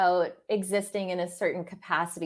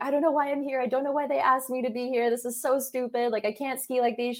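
higher-pitched upset rant, half-acted; upset retelling; 5 semitones higher, faster than usual. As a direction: replay the frustrated phone call to a friend, pitch raised. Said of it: "I was so upset and mad at myself".

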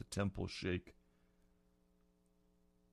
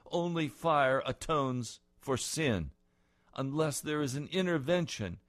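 loud, high, rising sermon voice; fervent preaching; 7 semitones higher, 6 dB louder than usual. Real, not acted. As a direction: build the vision with fire. Preaching at full force.

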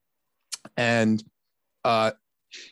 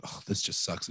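under his breath, nearly voiceless; whispered self-pity; 4 semitones lower, 9 dB quieter than usual. Retold or imitated. Dad griping to himself after losing phone, whispered. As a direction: hush it like a private groan.

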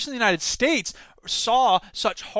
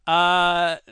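ordinary delivery

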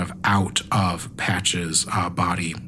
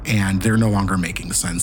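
ordinary delivery

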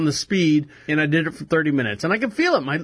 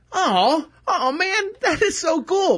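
much higher, gushing thrill; hearing happy birthday from the manager, high and thrilled; delighted; 11 semitones higher, slower than usual. Retold or imitated. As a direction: squeal with touched delight. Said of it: "And I'm, like, going".

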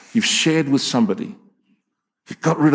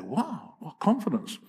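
much softer, half to himself; hushed awed aside; 5 semitones higher, 10 dB quieter than usual. Retold or imitated. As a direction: mutter it in awe.

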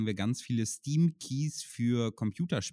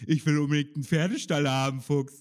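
mocking whiny friend; 4 semitones higher, 5 dB louder than usual. Mocking friend's childish wish.

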